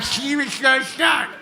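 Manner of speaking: slow, dopey half-asleep voice; a touch of drowsy slur; mock sedated. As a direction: drag it out like you took sleeping pills. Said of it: "took seven Ambiens".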